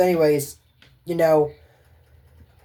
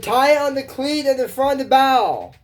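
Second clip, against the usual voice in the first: high, louder bossy order about the cleat; bossy girl voice; 9 semitones higher, 4 dB louder than usual. Acted. Miranda giving the pirate instructions, high and bossy. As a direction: bark the boat instructions. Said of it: "The cleat on the front of the bow".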